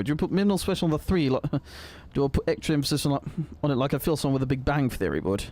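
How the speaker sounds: usual speaking voice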